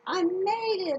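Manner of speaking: loud, high cry of triumph; triumphant call. Acted. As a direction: shout it out, proud you made it across. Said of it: "Austin calls".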